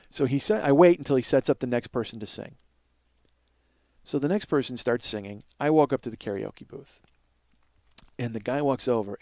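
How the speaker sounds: ordinary talk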